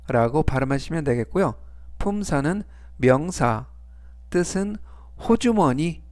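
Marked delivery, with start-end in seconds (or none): none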